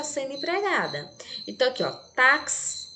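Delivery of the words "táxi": "táxi" with X sounded as K-S. Shown as a demonstration. Teacher demonstrating the sound in "táxi", X as KS. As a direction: say "táxi" with a crisp ks. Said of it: "esse X tem som de K S".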